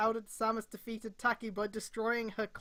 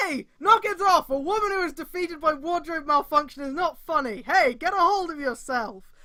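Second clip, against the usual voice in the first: much higher and louder scolding; hammed-up angry shout; 6 semitones higher, 9 dB louder than usual. Goro scolds the laughing Emu by the collar. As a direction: grab him and bark him back to sense. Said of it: "Goro grabs Emu by collar".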